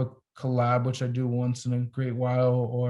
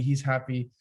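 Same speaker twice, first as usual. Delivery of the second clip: ordinary delivery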